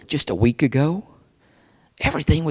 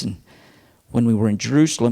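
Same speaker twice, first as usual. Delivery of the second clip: ordinary delivery, nothing marked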